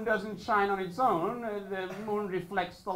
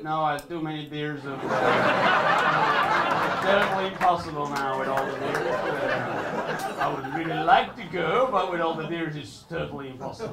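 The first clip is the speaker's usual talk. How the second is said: lower, whiny excuse with stifled laughs; mock excuse; 5 semitones lower, 3 dB louder than usual. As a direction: beg off with a lame mock excuse. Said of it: "people would ask me".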